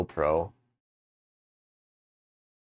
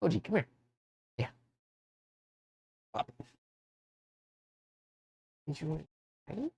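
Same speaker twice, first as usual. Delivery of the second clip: talking to a dog; 8 semitones higher, 9 dB quieter, faster than usual. Real, not acted. Calling the dog.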